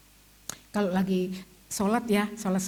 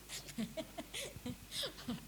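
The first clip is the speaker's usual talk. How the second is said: high laugh; 6 semitones higher, 15 dB quieter than usual. Real, not acted. Laugh at the gossip.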